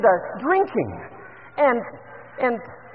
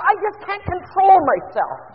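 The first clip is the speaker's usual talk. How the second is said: hammed-up helpless confession; 8 semitones higher, 7 dB louder, slower than usual. Mother admits she loses control.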